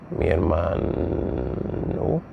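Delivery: very slow, stretching out "hermano"; drawn-out dictation. Real, not acted. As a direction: draw "hermano" out as if writing along. Says it while writing it out.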